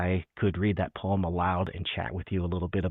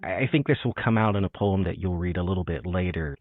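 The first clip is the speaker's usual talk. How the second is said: usual speaking voice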